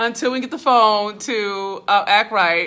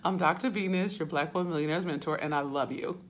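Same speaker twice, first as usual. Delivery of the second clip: softer, lower, faster repeat of the introduction; soft intro; 5 semitones lower, 8 dB quieter than usual. Real, not acted. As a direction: say it gently, almost to yourself. Restarts introduction softly, winding down.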